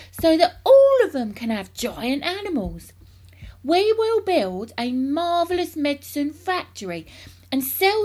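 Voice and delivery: high, loud, grand proclamation; carried away by a grand scheme; excited cry. A narrator's reading. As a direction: stretch the big words with relish. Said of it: "cried Mister Killycranky".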